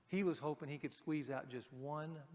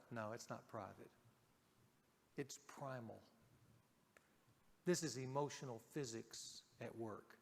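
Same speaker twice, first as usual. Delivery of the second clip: hushed inner thought; 6 semitones lower, 10 dB quieter than usual. Retold or imitated.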